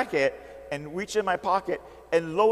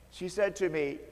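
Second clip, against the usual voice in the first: normal talking voice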